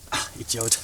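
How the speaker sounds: bitter whispered sigh, barely voiced; leise geflüstert. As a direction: mutter it to yourself in a whisper. Mourning the freed Russian quietly. (bitter geflüstert)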